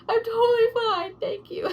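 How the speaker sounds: high, loud, crying through it; teary fake fine. Patient telling the nurse she's fine, crying. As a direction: insist you're fine while tearing up. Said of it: "I was like".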